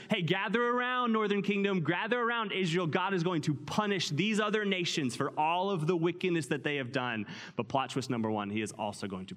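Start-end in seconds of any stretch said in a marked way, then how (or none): 0.1-5.2 calling out. Pretend. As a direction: summon everyone over. Calling Israel to gather.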